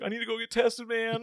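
mock-panicked plea; freaking out. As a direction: fret like a scared drunk kid. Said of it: "fucking freaking out".

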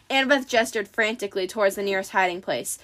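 ordinary delivery

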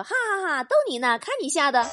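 husband laughing it off to his wife. Acted. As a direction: burst out laughing and tease her. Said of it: "她老公忽然哈哈大笑说".

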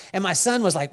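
normal talking voice

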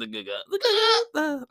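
high, strained "The go-go" as a mock old man.

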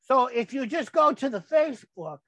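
ordinary talk, nothing marked

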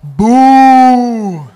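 long, loud crowd-style boo. Retold or imitated. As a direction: heckle with a drawn-out boo. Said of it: "stand up and go".

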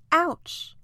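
high ouch, mildly pained complaint; pained cry. A narrator's reading. Poor island hurt as Lucy helps him, pained cry. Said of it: "cries the poor island".

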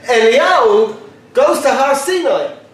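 ordinary talk

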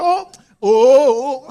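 loud drawn-out shocked oh; father dodging his wife's hug, loud mock alarm; recoiling father. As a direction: pull away from the hug, loud and slow. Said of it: "The man was like".

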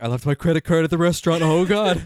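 mock panic. Voicing the king's expression as everyday panic, mock alarm. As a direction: rattle it off like you just realised a blunder. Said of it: "That look of, like".

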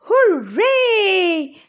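high, loud, laughing cheer; gnome shouting hooray. Acted. Gnome cheering Wilma's victory, high, loud and laughing. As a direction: cheer the dragon away like a giddy gnome. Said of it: "shouted the gnome".

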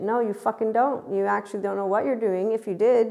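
heated, emphatic contradiction; indignant rebuke. Real, not acted. Telling off self-styled activators, indignant rebuke. Scolding the people she mimicked.

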